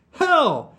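loud, high outburst of anguish; high, loud wail of horror; anguished cry. A narrator's reading. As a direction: cry out in dismay, pitch shooting up. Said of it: "Vlad cried in anguish".

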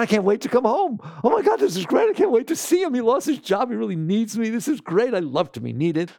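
high, bright-eyed gushing; mock gush, over the moon to come home; gushing happy mind. The happy mind gushing about coming home, high and excited. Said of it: "your mind said".

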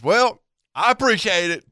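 high, loud mock female voice; drunk woman. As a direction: holler thanks as a tipsy woman, imitated. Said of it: "she is so drunk she can't stand up".

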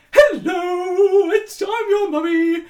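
a dad singing out as a comic mum; a hammed-up sing-song mummy greeting; silly put-on lady voice. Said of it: "affected a stupid female accent".

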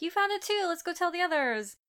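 high, eager retold exclamation; lightly hammed-up delight; imitated excitement. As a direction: play an excited child, pitched well up. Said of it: "is so excited".

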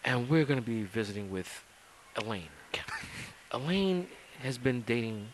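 hushed dramatic host whisper; mock Cheaters host whispering the setup; Joey Greco whisper. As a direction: whisper it like a reality-show reveal. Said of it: "I'm Joey Greco".

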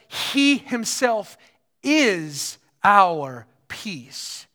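"He himself is our peace" very slow, each word weighted; emphatic and slow. Real, not acted. Preacher quoting Paul to congregation, slow emphatic "He himself". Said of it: "Jesus, it's emphatic".

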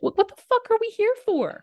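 high, exasperated outburst. Real, not acted. Ranting about the military.

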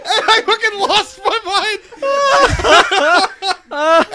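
high, slow, helpless laughter in the words; laughing hard. Real, not acted. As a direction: say it cracking up at your own story. Laughing at own story.

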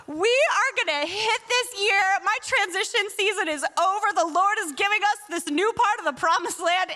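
high, eager and sure of the year ahead; bubbling with excitement about twenty twenty; excited hope. Retold or imitated. Pastor sharing her hopes with the congregation, mock excited. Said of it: "I'm thinking".

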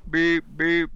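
high beep-beep like a reversing truck. Retold or imitated. Host imitates the Falcon backing up, high beeping. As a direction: mimic a vehicle backing up.

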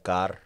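"car" with the final r rolled. Shown as a demonstration. Showing how Glaswegians talk, "car" with a rolled r. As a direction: roll the r at the end of "car". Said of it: "So they roll their R's".

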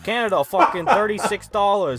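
high-pitched exaggerated Canadian-New York accent; fake Canadian, New York accent. Reading book prices aloud, exaggerated Canadian-New York accent. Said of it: "I went into my fake Canadian accent with my big New York accent".

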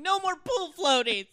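loud, high hysterical wail; hammed-up screamed outburst; mock screaming. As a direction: scream it like a comic meltdown.

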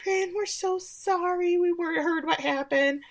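high, falsely sweet apology; fake-sweet neighbor apologizing at the door; sugary Annie voice. Friend imitates Annie's falsely sweet high voice. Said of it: "she's like".

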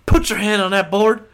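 punchy mock military bark; barked movie command. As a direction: act out the instructor's forceful command. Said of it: "The O G Starship Troopers".

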